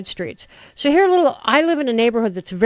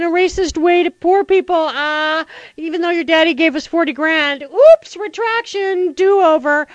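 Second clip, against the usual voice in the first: hammed-up bumbling president voice, higher and louder; mocking Bush; 9 semitones higher, 3 dB louder than usual. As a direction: play a fumbling president botching a pardon. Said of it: "Bush is busy bumbling around".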